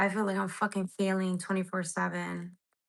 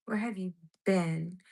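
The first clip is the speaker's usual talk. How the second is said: ordinary talk, nothing marked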